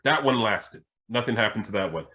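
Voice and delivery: ordinary delivery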